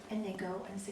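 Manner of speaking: usual speaking voice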